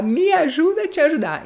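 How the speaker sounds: teacher voicing a baffled listener; mock puzzled listener. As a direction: play a confused listener begging for help. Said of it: "o ouvinte vai pensar".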